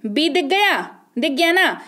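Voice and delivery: ordinary delivery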